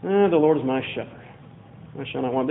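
boring tired voice. Shown as a demonstration. Bored churchgoer skim-reading the Psalm, tired voice. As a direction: read it like it's a chore. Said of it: "boring, tired voice".

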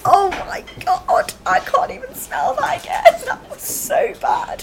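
high, teary, revolted gasps; disgusted. Real, not acted. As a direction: recoil from a foul smell. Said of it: "I've got tears in my eyes".

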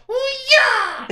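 high, loud puppet karate yell; karate cry. Acted. Puppet showing a karate move, high shout. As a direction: shriek a martial-arts "hi-ya" as a dummy. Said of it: "Então mostra um golpe aí".

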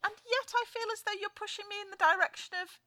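high, suspicious woman voice; woman character. Acted. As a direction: play a wary shopper. Woman role in sketch.

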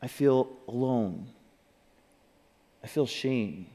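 low, soft, spaced-out admissions; quietly name the feeling aloud to yourself; said to oneself. Shown as a demonstration. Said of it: "say it to yourself out loud".

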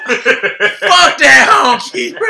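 loud, slow, laughing yell; retold laughing outburst at the drunk guy; laughing shout. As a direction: bellow it through laughter.

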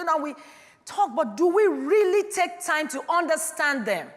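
loud, high, ringing pulpit voice; full-force preaching. Acted. Pastor rousing the congregation, preaching at full force. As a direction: preach it out to the whole hall.

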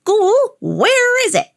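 high-pitched demanding call; hollering old man. A narrator's reading. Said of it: "hollered out".

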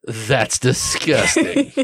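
slow, giggling through the words; laughing disgust. Acted. Liliana watches biscuit spit out, laughing. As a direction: laugh out a gross-out.

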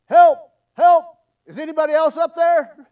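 very loud, high shout; yelling for help. Acted. Man on a cliff rejects God's advice, yelling for help. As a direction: holler past the first rescuer for another one. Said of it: "Finally, Jack yelled".

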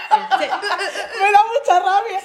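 slow, high, words broken by laughter; laughing through it. Real, not acted. Friend at the podcast table laughing while she complains about sidestepping.

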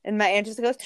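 normal talking voice

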